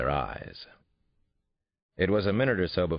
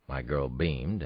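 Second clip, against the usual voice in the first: ordinary delivery, nothing marked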